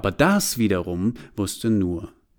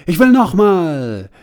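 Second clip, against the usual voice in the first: high and loud, wanting more right now; eager demand; 9 semitones higher, 10 dB louder, slower than usual. A narrator's reading. Desire eagerly demands another surprise. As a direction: demand it like a greedy child.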